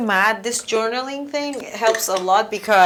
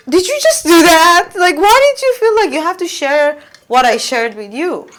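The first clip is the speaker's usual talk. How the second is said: higher, louder stunned disbelief; shocked indignation; 7 semitones higher, 6 dB louder than usual. Retold or imitated. Retold reaction to the instructor blurting out her trip, in shocked disbelief. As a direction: blurt it out like you can't believe it.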